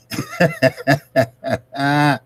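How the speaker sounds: long, high-pitched run of chuckles; real laugh. Real, not acted. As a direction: crack up at yourself. Laughing after admiring the woman.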